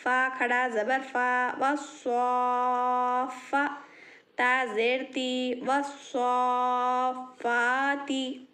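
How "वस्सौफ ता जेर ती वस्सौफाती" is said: long held vowels, very slow; slow chanted spelling; drawn-out recitation. Shown as a demonstration. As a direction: stretch each sound like Quran reading. Teacher drawing out vowels.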